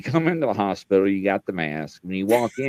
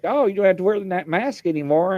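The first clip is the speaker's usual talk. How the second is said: quick, higher mock doctor voice; breezy doctor; 8 semitones higher than usual. Retelling his doctor telling him to drop the mask, quick higher mock voice. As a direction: toss it off brightly like a busy doctor. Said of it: "she's like".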